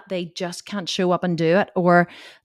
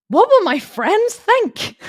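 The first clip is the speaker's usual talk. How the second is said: high, slow, whiny fretting, louder and much higher; mock worried husband; 12 semitones higher, 7 dB louder, slower than usual. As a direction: ham up his fussy worry. Husband's worried objection, retold.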